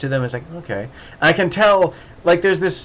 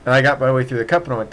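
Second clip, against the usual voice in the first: usual speaking voice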